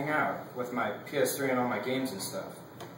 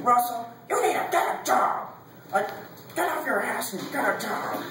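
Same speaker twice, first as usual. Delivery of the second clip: nagging parent; 9 semitones higher than usual. Retold or imitated. Student imitating parents nagging him about a job, nagging. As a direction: pester him in a raised, high-pitched parent voice.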